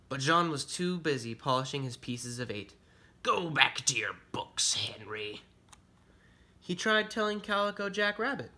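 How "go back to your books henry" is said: pirate voice, brushing someone off; dismissive pirate. Acted. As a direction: send the bookworm away like a pirate. Busy pirate brushes Henry off.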